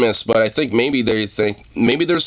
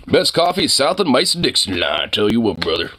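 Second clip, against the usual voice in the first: southern huckster. Acted. Streamer bragging about imaginary southern coffee, mock huckster.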